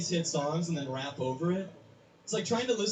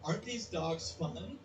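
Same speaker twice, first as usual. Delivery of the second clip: much softer and slow, like a redub; the girl in the changed ad, softer and slow; softer, slow dubbed line; 10 dB quieter, slower than usual. Retold or imitated. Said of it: "Instead now she goes".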